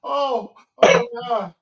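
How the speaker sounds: loud high laughing exclamations; laughing hard. Real, not acted. Cohost howling with laughter at the impression, loud and high. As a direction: cry out through big laughter.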